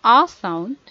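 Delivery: "a" said as an open "ah", loud; open a sound. Shown as a demonstration. Teacher gives a clue on verb beginnings, "a" as "ah". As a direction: say "a" as a full "ah" vowel. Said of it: "starts with a sound".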